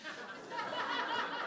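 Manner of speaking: high breathless laugh. Real, not acted. Teller laughs at the broken weekend machine, high laugh. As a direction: burst out laughing at the absurdity.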